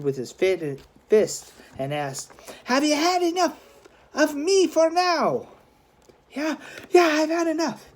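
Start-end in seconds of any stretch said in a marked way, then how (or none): 2.6-5.5 put-on victorious boy. 6.3-7.9 high, defeated giving in; beaten gang tough. Acted.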